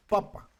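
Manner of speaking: papa with both A vowels short. Shown as a demonstration. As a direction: say "papa" with quick, short A's. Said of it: "esta A es una A corta".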